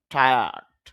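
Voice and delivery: টায়ার্ড said as "taiad", no r sound; correct pronunciation. Shown as a demonstration. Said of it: "শুদ্ধ উচ্চারণটা হবে". (টায়ার্ড শুদ্ধ উচ্চারণে, র প্রায় বাদ দিয়ে)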